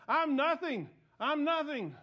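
loud, high echo of the pastor's cry; imitative loud cry. Billy beside the pastor, copying his loud cry. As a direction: copy the pastor's wail, full volume. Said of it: "begins to say the same thing".

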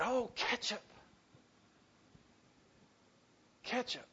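soft and wondering, with long pauses; a poor man quietly thanking God for ketchup; Billy's grateful praise. Retold or imitated.

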